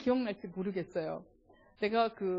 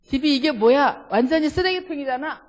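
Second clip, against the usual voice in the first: loud, higher nagging about the messy house; husband scolding his wife over the mess, loudly; scolding complaint; 4 semitones higher, 8 dB louder than usual. Shown as a demonstration. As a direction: complain hard that the house is a dump. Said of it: "남성분들 해봅시다".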